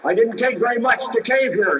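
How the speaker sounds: usual speaking voice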